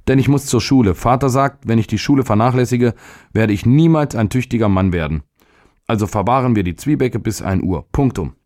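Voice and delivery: high-pitched, determined schoolboy; insistent boy. Acted. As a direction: argue back like a small boy. Narrator voices the boy.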